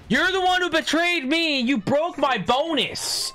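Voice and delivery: high-pitched betrayed outcry; outraged yell. Real, not acted. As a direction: yell it like you've been stabbed in the back. Betrayed in game, yelling.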